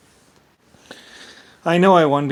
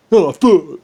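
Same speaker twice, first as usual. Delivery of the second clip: mock dismay; 5 semitones higher, 12 dB louder than usual. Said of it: "enemy reacting in retold scene".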